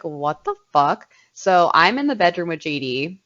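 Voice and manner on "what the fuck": slow, stunned disbelief; shocked. Retold or imitated. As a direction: drag it out in confusion. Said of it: "And I was like".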